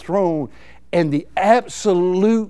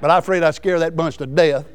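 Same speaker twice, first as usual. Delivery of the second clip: ordinary talk, nothing marked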